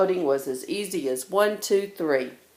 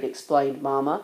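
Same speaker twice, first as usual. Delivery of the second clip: ordinary delivery, nothing marked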